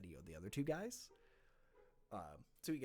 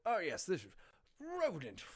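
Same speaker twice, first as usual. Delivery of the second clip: higher, louder, flustered greeting; flustered captain; 10 semitones higher, 9 dB louder than usual. Acted. Captain recognizing Ronan, flustered. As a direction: catch yourself mid-name. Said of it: "He goes".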